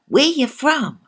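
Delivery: high, loud Jamaican lilt; Jamaican accent. Pretend. Host repeats in Jamaican accent.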